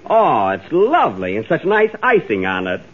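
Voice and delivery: ordinary talk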